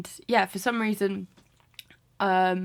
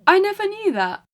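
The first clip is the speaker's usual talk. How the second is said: surprised; 12 semitones higher, 6 dB louder than usual. Real, not acted. Surprised at parents' story.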